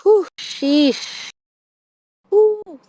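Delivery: high whew of relief; big exhale. Real, not acted. Reader composes herself after a big reveal. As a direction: blow it out, composing yourself. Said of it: "compose myself".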